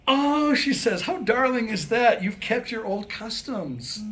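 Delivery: loud, high, sweetly gushing lady; high, loud, delighted coo, slowing at the end. Acted. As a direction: melt over it like it's adorable. Woman gushes, delighted.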